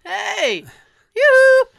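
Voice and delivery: high, loud singsong yoo-hoo; sing-song call. Retold or imitated. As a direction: call out playfully for attention. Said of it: "you know".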